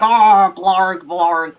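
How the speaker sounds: very loud zombie roar; zombie attack. Acted. Imitating zombies running up to you, loud mock roaring. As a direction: roar out nonsense like a lunging zombie. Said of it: "zombies just running up to you".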